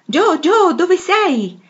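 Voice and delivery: high, loud call up the attic stairs. A narrator's reading. Meg calls up to Joe in the attic, loud and high. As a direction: shout for her sister from below. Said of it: "gridava Meg".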